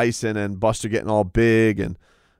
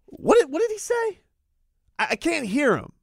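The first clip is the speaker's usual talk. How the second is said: high, strained mock-confused voice, much higher; Dana pretending deaf. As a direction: play dumb, pitched way up, feigning not hearing. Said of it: "Dana just kept doing this".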